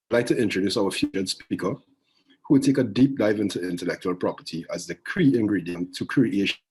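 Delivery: usual speaking voice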